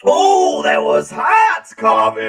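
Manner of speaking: loud, high, over-the-top character; hyped-up high voice. Acted. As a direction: shout it like a cartoon host.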